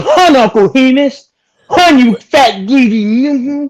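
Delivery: high, loud, slow mocking drawl; hammed-up villain taunt. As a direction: stretch each word, gloating high and loud. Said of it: "he goes, he goes".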